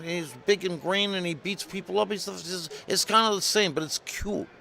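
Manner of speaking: high, loud Jersey sales patter; Jersey pitchman. Retold or imitated. Jersey caller explaining turtles by TV comparisons, hammed-up eager. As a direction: keep pushing the idea in a put-on Jersey voice. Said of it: "some guy from Jersey".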